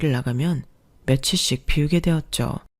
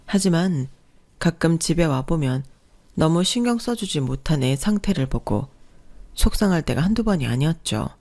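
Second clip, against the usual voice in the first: normal talking voice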